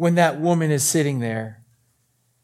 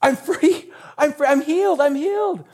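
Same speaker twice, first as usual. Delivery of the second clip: high-pitched, jubilant exclamations; jubilation; 9 semitones higher than usual. Acted. Healed woman announcing it to the crowd, jubilant. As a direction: burst out with joy, voice lifted high. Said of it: "I can't imagine anything except jubilation".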